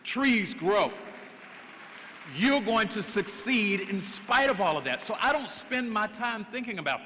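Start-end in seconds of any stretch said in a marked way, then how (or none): 0.0-5.0 louder, preacher-style proclamation; rousing declaration. Acted. Speaker inspiring the audience, loud rallying proclamation. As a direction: build it into a rallying cry.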